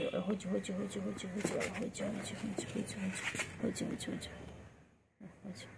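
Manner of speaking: soft whispered chant of a nonsense word; playful whisper. Real, not acted. Owner playfully whispering a silly word at her bird.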